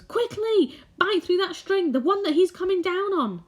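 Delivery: put-on high, loud urgent boy voice. James urging the centipede, high loud boy voice. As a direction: urge him on quickly.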